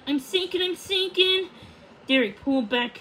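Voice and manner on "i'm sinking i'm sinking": high, rising alarmed repetition; panicked cry. A narrator's reading. Odette crying she is sinking, panicked. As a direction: cry out as you drop.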